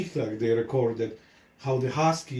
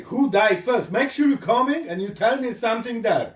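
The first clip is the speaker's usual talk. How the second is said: louder, higher, fast retelling of his own demand; raised quoted demand; 8 semitones higher, 4 dB louder, faster than usual. Retold or imitated. The teller to his friend, a hurried raised demand. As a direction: repeat what he told the dying man, pushing fast and loud. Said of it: "before I say".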